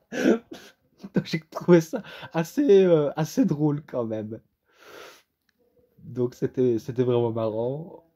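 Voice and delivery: talking through laughter, high and loud; real laughter. Real, not acted. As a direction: crack up while getting the words out. Cracks up at the retold promo.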